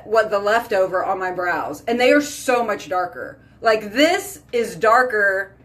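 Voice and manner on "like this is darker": normal talking voice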